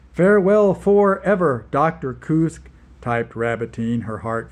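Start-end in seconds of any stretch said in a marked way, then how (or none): 0.1-2.7 dramatic farewell. Acted. Dramatic farewell text read aloud.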